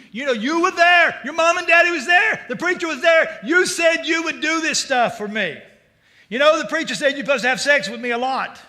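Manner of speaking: loud, high shouting tirade; angry wife. Retold or imitated. Wife berating husband for unkept promises, shouting angrily. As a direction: rant at him like a fed-up wife.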